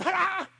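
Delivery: high, loud sharp ah; sharp parental ah. Retold or imitated. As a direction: cut the kid off mid-word. Parent snapping at child.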